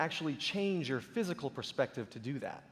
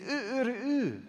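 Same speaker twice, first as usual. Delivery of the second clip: mock Turkish rooster; 6 semitones higher, much slower than usual. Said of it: "In Turkey, they say".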